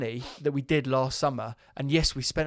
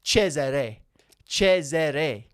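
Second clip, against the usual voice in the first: Cesare said the Italian way, CHE-ze-re, falling; a light mock-Italian Cesare, tongue in cheek; Italian accent; 4 dB louder, much slower than usual. Shown as a demonstration. Co-host tries the name in an Italian accent, mild and playful. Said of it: "I'm just doing my Italian accent".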